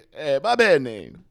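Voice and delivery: high hammed-up Italian dad; mock Italian dad. Dad apologizing in the family, mock Italian. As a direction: shrug it off like a sheepish dad. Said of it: "Dad's like".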